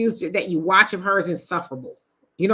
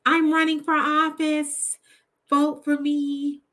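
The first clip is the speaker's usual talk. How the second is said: higher, slow, breezy mock campaign voice; mocking naive candidate; 8 semitones higher, slower than usual. Streamer mocking Kamala's naive campaign pitch, slow and lilting. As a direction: parody a candidate who thinks it's easy. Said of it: "I think that's what she thought".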